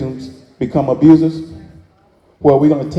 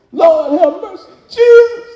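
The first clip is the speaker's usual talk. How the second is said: high, slow wailing to the Lord; wailing cry; 7 dB louder, slower than usual. Retold or imitated. Preacher imitating grieving people crying out to Jesus, a high wail. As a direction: imitate anguished mourners crying out.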